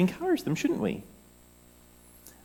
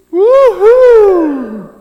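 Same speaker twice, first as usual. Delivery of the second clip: high, loud cheer; cheering woohoo; 14 semitones higher, 12 dB louder than usual. Shown as a demonstration. Children's talk leader demonstrating a cheer, loud whoop. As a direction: cheer like a teammate took a mark. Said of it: "You say".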